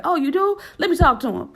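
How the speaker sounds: high, loud eager reply; eager surprise. Retold or imitated. Agent offering to talk to the FBI, high loud eager. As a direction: jump at the chance cheerfully.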